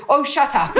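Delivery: high retold dismissal with laughter; mock exasperation. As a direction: imitate a fed-up driver, amused. Retold driver cutting her off.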